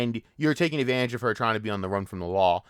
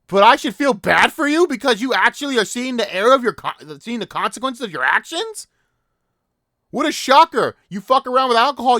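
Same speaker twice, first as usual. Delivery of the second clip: furious rant; 8 semitones higher, 7 dB louder than usual. Real, not acted.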